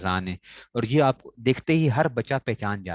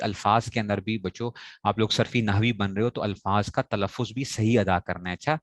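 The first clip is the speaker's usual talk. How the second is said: usual speaking voice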